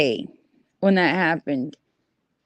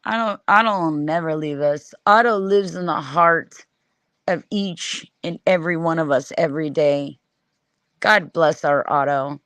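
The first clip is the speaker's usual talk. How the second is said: mock tender; 5 semitones lower, 3 dB quieter than usual. Mock sentimental eulogy for Otto.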